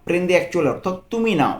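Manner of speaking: ordinary talk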